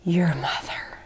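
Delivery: hushed, unvoiced whisper. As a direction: lawyer whispering, overcome with emotion. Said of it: "and he just goes".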